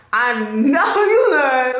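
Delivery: high, slow, laughing "you lying"; laughing disbelief. Retold or imitated. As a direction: brush off God's call with a disbelieving laugh. Answering God in her story.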